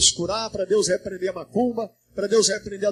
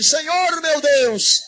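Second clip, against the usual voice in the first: slow, drawn-out raised cry; shouted prayer cry; 5 semitones higher, 6 dB louder, much slower than usual. Shown as a demonstration. Pastor showing the church how to cry out, drawn-out call. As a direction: call it out for the crowd to echo. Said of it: "Grita comigo assim".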